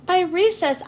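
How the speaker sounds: usual speaking voice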